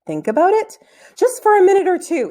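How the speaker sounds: urgent coaxing. Acted. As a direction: beg him to wait a minute. Boy urges friend to wait.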